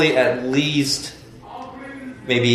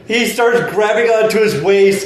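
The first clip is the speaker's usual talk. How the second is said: ordinary talk, nothing marked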